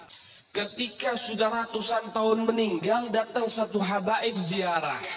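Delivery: slow it right down for emphasis; slow, drawn-out retelling. Shown as a demonstration. Preacher repeats the story line slowly to the congregation. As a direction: stretch every word out slowly. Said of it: "repeats the line slowly".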